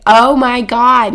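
amazed. Retold or imitated. Retold amazed reaction to building.

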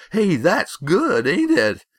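high, loud, pleased-with-himself crowing; crowing delight. A narrator's reading. Bully showing off his joke to the boys, crowing. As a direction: crow over your own joke.

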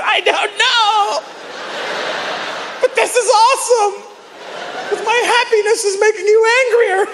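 high voice, laughing through the words. Retold or imitated. A man laughing at his angry partner, high giggling reply. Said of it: "the more I laughed".

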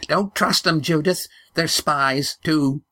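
high, urgent grandmother voice; old woman warning Judith off the strangers, high; old woman's warning. Acted. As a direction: warn her off in a high old voice.

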